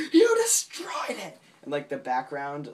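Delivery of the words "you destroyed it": very high, loud, drawn-out accusation; hammed-up cartoon outrage, pitched way up; mock emphatic yell. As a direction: imitate a ride operator shrieking in accusation. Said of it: "put emphasis on what he's saying".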